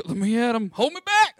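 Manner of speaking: rising high, loud mock fury from a small kid; mock fighting shout. LaShawn yelling at the bully, hammed-up fury. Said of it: "LaShawn was like".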